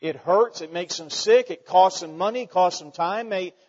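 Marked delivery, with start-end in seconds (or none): none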